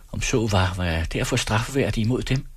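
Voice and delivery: ordinary delivery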